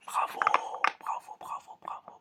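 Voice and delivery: ordinary talk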